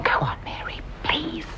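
whispered plea; soft, insistent whisper; hushed urging. Acted. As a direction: urge her on under your breath. Urging friend under her breath.

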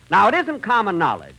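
ordinary delivery

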